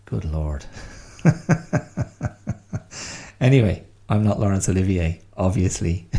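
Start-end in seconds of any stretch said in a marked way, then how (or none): 0.7-3.4 high, airless laugh; breathy laugh. Real, not acted. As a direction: let out a laugh at yourself. Laughing at own reading.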